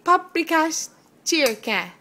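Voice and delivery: "Paprikash chirka" slow, loud and high; slow word modelling. Shown as a demonstration. Parent teaching toddler to say "Paprikash chirka", slow modelling. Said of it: "How about paprikash?".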